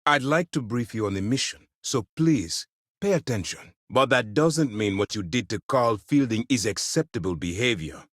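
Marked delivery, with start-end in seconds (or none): none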